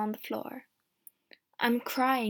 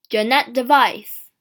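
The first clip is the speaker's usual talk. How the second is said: louder call down the cell; 12 dB louder than usual. Acted. Guard shouting into the prison cell, loud. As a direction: bellow the prisoner's name. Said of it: "the guard calls".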